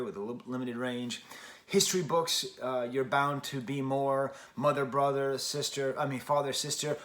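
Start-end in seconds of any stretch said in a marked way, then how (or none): none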